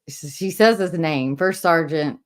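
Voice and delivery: ordinary delivery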